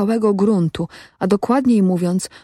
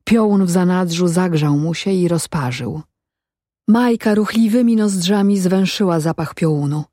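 plain reading voice

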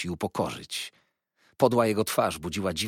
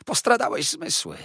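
much higher and louder wheedling cry; shocked Uriah; 14 semitones higher, 6 dB louder than usual. A narrator's reading. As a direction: squeal in pained disbelief. Uriah shocked after the slap. (piskliwy, zszokowany okrzyk)

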